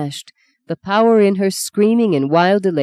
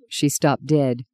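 ordinary delivery

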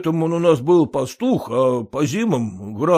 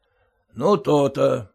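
usual speaking voice

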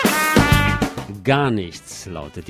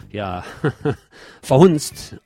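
hesitate, chuckle, then say the word; real little laugh breaking in before the word; amused chuckle; 2 semitones higher, 4 dB louder, slower than usual. Real, not acted. Reporter telling how the troupe mocked Catalonia's patriarch, amused.

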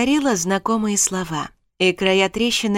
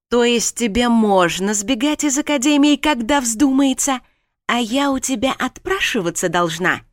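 indignant; 5 semitones higher, 4 dB louder than usual. Acted. Alexia argues with husband.

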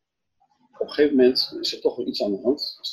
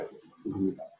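softer, sinking "Je kunt er niet bij"; hushed repeat; 3 semitones lower, 9 dB quieter than usual. Shown as a demonstration. Preacher repeating it softly to the listeners, hushed. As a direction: let "niet bij" fall away softly.

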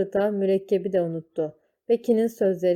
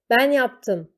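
put-on higher, louder boyish confession shout; 5 semitones higher, 6 dB louder than usual. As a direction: blurt it out, standing up to save her. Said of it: "diye bağırdı".

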